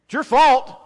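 loud, high-pitched reproach; accusing outburst, voice pushed up high; pointed accusation. Retold or imitated. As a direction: throw the blame at him, loud and rising in force. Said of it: "asking some pretty pointy questions".

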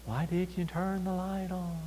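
soft, slow, small voice; little background voice. Acted. Someone woken at night asks about the light, little background voice. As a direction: call faintly, like someone half-asleep in another room. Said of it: "this little voice in the background".

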